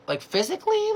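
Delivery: high-pitched, incredulous check; shocked question. Retold or imitated. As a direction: jump way up in disbelief. Shocked reaction to attack story.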